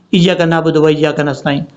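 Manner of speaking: 'ایاک نعبد و ایاک نستعین' run together; hurried wrong recitation. Shown as a demonstration. Teacher imitates people's haste, hurried recitation. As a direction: rush 'ایاک نعبد و ایاک نستعین', skipping long vowels and ع. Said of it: "لوگ جلدی میں پڑھتے ہیں".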